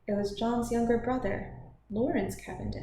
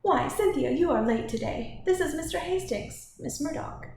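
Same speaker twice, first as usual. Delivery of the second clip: high-pitched lady making introductions; animated matron's greeting; effusive hostess; 8 semitones higher, 3 dB louder than usual. Acted.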